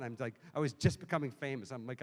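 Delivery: ordinary delivery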